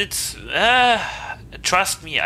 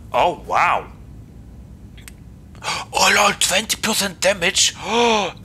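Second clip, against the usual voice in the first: gasping excitement; 6 semitones higher, 4 dB louder than usual. Real, not acted. Gasping at a great perk.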